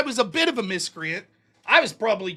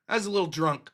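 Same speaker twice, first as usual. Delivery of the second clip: ordinary talk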